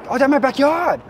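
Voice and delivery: high mock Tongan accent; roughly faked Tongan accent, way up high; Tongan bad-English impression. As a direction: answer as a Tongan man with broken English.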